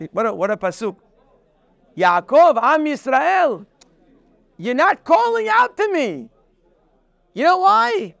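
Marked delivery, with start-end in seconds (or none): 2.0-8.1 raised, higher divine call to Israel; calling out as God. Acted. Preacher voicing God calling Israel, raised pleading call.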